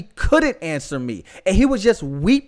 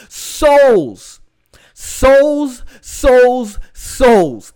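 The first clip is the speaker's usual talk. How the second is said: top-of-lungs yell; 4 semitones higher, 8 dB louder, much slower than usual. Retold or imitated. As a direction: imitate a drawn-out, anguished yell, higher and much louder. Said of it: "he yelled out at the top of his lungs".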